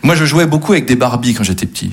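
usual speaking voice

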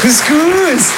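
proud mother's high sing-song announcement; mother announcing. Retold or imitated. Son imitates his mother presenting couscous, high and bright. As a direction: call it out high like mum serving dinner. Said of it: "elle arrive, elle fait couscous".

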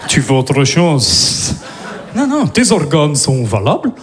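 low, slow Russian thug; slow deep Russian-accented threat; menacing Russian accent. Acted. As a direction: answer like a scary gangster. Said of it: "je fais".

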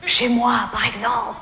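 low-voiced amorous man; Jean-Loup. Voicing Jean-Loup's invitation. (voix grave, invitation amoureuse)

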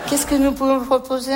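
ordinary talk, nothing marked

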